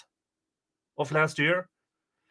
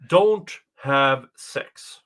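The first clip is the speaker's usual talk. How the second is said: slow emphatic; much slower than usual. Real, not acted. Spelling out the doctrine.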